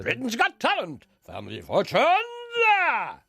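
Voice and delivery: high show-title announcement; Peter Dixon. Acted. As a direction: stretch the titles like a programme opener. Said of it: "He not alone does the voice of X Factor".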